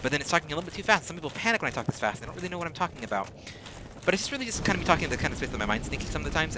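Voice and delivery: racing through the words very fast; breathless fast ramble showing how she talks when excited; rapid-fire talking. Shown as a demonstration. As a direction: rattle it off as fast as the mind runs. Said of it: "I start talking like this".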